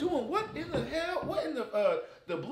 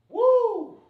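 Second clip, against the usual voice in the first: whoop; 11 semitones higher, 9 dB louder than usual. Acted. Burst after laugh.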